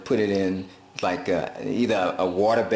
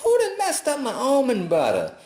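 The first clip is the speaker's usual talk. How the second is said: mock crying outrage; 4 dB louder than usual. Retelling tasting sugary almond butter, mock crying dismay. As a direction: wail over ruined almond butter. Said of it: "I said".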